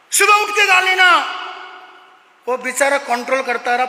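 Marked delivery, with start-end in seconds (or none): 0.1-1.2 high, loud irritated order; exasperated shout. Acted. Sleepy father shouting at son to sleep, loud. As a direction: snap it like a tired dad.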